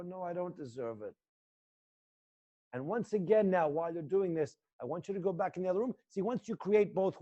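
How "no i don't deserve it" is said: soft, far-off admission; quiet self-denial. Shown as a demonstration. Son answering his own reflection, a soft quiet admission. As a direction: say it quietly into the mirror. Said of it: "Look in the mirror and go".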